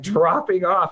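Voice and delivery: ordinary talk, nothing marked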